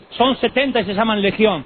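ordinary talk

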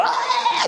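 mock drowning cry. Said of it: "me esté ahogando y yo digo".

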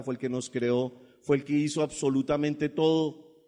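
normal talking voice

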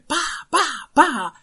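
loud, high mallet blow sounds; mallet whacks. Retold or imitated. Teller imitates doctor's mallet blows, loud bangs. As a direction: bang out three hits.

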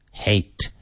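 "Hate" with a light breath on the H; softened, breathed H. Shown as a demonstration. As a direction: let air carry the start of "Hate". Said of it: "So soften it. Breathe".

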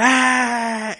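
high, loud stadium yell; hired extra roaring for the camera; mock fan roar. Mocking fake fans cheering.

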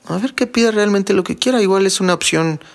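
light mock female voice; imitating a woman. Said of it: "la mujer está pensando".

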